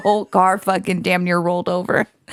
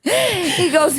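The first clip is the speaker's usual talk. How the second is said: ordinary delivery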